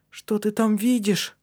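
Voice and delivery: high, slow, hopeful asking; eager question. A narrator's reading. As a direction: ask it with pent-up anticipation. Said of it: "спросил он".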